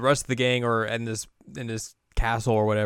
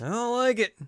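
put-on childish whine; 12 semitones higher than usual. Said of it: "She's like".

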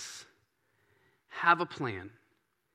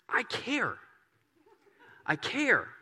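tender God voice; 10 semitones higher, much slower than usual. Acted. God reassuring an anxious believer, high and tender. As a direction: reassure like a loving father. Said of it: "He says".

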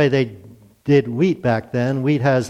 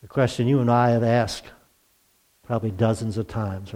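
normal talking voice

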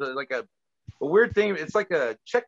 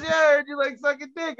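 high-pitched imitation of a taunter; taunting stranger; 10 semitones higher than usual. Wrestler on a bus needling a colleague, imitated taunt. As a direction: mimic the stranger, pitched well up.

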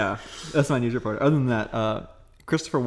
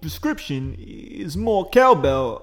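slow, halting Walken cadence; Christopher Walken impression; 3 semitones higher, slower than usual. Host imitates Walken quote, slow halting cadence. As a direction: drag each phrase out like Walken. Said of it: "And it's".

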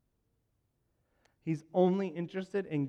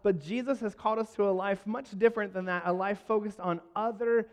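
louder, higher, fast preaching climax; preaching at full force; 5 semitones higher, 5 dB louder than usual. Acted. Pastor urging the church to look past themselves, fervent. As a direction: drive the point home to the congregation.

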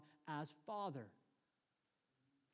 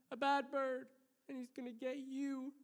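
put-on high, small-child voice warning dad; Joshua; 7 semitones higher than usual. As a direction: imitate a little boy's earnest warning. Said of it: "And it was Joshua".

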